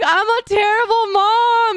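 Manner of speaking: high, slow mock sob; retold crying. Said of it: "I'm like".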